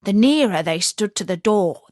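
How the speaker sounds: ordinary delivery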